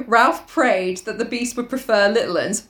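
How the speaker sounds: ordinary delivery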